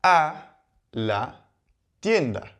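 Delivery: each word set apart by long pauses; slow spaced example. Shown as a demonstration. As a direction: say the Spanish example slowly, word by word. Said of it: "we could say".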